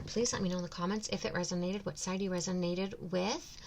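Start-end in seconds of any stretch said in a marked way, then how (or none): none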